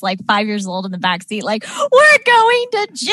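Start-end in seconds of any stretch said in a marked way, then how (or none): 1.6-3.1 high, loud, drawn-out mock wail; child's panicked wail. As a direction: imitate a five-year-old wailing in dread. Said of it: "like, the child crying in the backseat".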